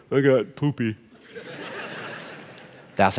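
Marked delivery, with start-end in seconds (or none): 0.1-1.0 put-on toddler voice. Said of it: "What's the two-year-old telling her".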